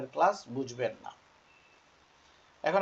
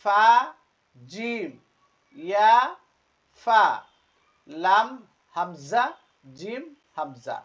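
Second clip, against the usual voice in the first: each letter name higher and drawn out; letter-by-letter recitation; 5 semitones higher, 9 dB louder, much slower than usual. Shown as a demonstration. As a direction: recite slowly for learners to repeat, pausing between letters. Reads letters for learners. (টেনে টেনে হরফ পড়া)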